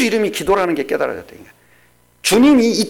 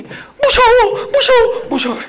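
put-on high, slow crying child's voice; imitating scared child; 3 dB louder, slower than usual. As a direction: whimper like a frightened toddler. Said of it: "그 아줌마를 보더니". (겁먹은 아이 흉내)

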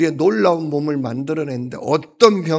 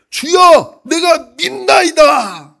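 a desperate shout, much higher and louder, slow; crying out; 12 semitones higher, 7 dB louder, much slower than usual. Acted. The father shouting his faith to Jesus, a desperate cry. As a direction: stretch every syllable as a desperate cry. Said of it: "소리를 질러 이르되".

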